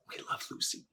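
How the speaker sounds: soft, reverent near-whisper; hushed. Real, not acted. Telling of the lost weekly TV ritual, hushed. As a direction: say the title very softly, almost voiceless. Names beloved show after pause.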